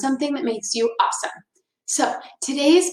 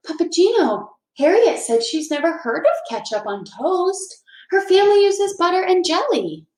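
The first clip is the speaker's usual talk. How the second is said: put-on higher little girl voice; light, higher child voice; Lucy's voice; 7 semitones higher than usual. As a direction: voice a young girl telling grandpa. Lucy voiced in story.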